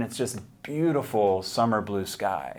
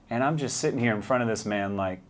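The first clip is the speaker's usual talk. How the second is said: ordinary talk, nothing marked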